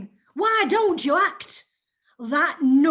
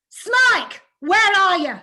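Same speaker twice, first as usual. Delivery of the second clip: louder, higher call; 4 semitones higher, 6 dB louder than usual. Acted. Mrs Squeers calls sharply for Smike. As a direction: call across the house.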